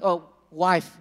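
normal talking voice

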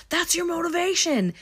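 high-pitched acted outburst; upset, aggressive imitation. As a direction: throw it out as a hostile taunt. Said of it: "really upset".

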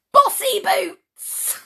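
slow, high, gleeful shout; punchline shout. A narrator's reading. Reader revealing what Miss Bossy fears, punchline shout. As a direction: blurt out the big reveal.

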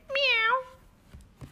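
high cat meows. Acted. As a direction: meow like a cat. Cat meowing.

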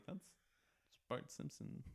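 slow hushed whisper. Retold or imitated. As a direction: whisper it like a secret. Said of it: "I'm like".

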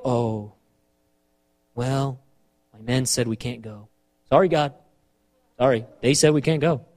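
low, quiet, sheepish apology; mock-feeble excuse, dropping low and soft; meek excuse. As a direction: apologize to God like a pushover. Acted meek excuse to God.

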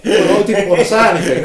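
laughing. Real, not acted. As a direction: say it through a laugh. Laughing at host's dingy flat.

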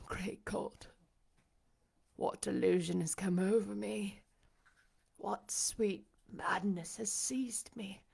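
hushed disbelief, with long pauses; wondering aloud at his own senses; stunned blind master. A narrator's reading. Rochester doubting his senses, in stunned wonder.

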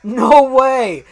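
loud, high delighted outburst; excited disbelief. Real, not acted. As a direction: burst out in happy disbelief. Reacting to the famous fight.

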